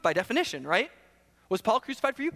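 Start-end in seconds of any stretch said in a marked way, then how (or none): 1.5-2.3 higher, puzzled question; literal-minded reader. Acted. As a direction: ask it as if in earnest. Reader taking sarcasm as real question.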